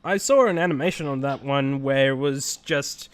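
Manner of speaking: normal talking voice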